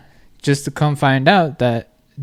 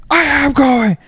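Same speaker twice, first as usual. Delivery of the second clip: high-pitched mock yell; retold yelling; 11 semitones higher, 4 dB louder, slower than usual. Jet skier refusing to calm down, imitated high yelling. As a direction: mimic the man's worked-up protest. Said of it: "expecting him to yell at me".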